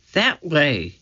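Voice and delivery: ordinary delivery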